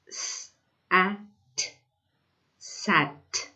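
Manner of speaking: "S-, a-, t" sound by sound, then "sat" blended; sounding out words. Shown as a demonstration. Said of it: "So what does it say here?".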